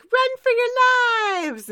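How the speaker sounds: very high, loud, slow shriek; panicked shriek. Retold or imitated. Pinkie Pie to the crowd, panicked shriek. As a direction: scream it in panic. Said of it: "She's like".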